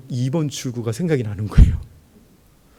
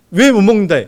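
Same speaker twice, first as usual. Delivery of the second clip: loud, high challenge; a boss's loud, high demand at a drinking party; 6 semitones higher, 11 dB louder, faster than usual. Retold or imitated. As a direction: bark the question like an angry boss. Boss confronts him.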